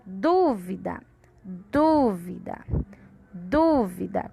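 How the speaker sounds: "Dúvida" slowly, with a long strong "Dú"; stress on du. Shown as a demonstration. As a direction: punch the first syllable of "Dúvida". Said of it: "É a sílaba du".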